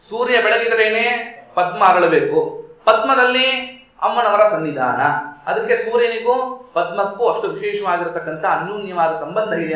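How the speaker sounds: normal talking voice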